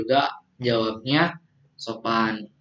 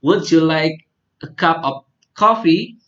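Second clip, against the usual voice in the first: higher, louder model with coffee stressed; teacher lifts the question for pupils to echo; teacher drill; 4 semitones higher, 5 dB louder than usual. Shown as a demonstration. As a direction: model "Would you like a cup of coffee" for repetition. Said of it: "Repeat after me".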